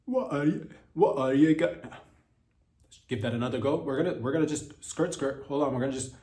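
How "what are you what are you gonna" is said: higher, louder attempt that comes out Scottish; a mock accent sliding Scottish; accent attempt turning Scottish. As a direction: reach for the accent and slip into Scottish. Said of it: "just turns into Scottish".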